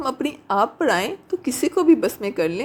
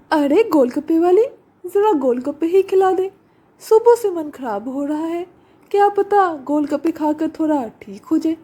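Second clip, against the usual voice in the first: Sapna's voice; 5 semitones higher than usual. Acted. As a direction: call out sweetly to the seller. Sapna calling the seller.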